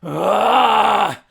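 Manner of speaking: loud roaring bellow; rageful bellow. Acted. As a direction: bellow as you charge. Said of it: "letting out a rageful bellow".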